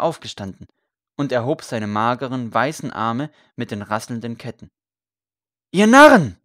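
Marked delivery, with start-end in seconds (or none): 5.7-6.4 full-force high shout; shouted rebuke. A narrator's reading. Condemned priest scolds the rioting crowd, loud shouted rebuke. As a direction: cry out to stop a mob at once. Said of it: "rief er".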